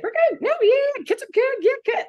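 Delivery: high, bright, breezy surface chatter; chirpy small talk. Retold or imitated. Friend reconnecting brushes it off with high chirpy small talk. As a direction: toss off a cheery everything's-fine reply.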